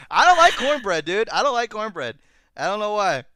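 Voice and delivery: high, loud outburst; loud protest. Real, not acted. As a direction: belt out an exasperated confession. Loud protest about hating cornbread.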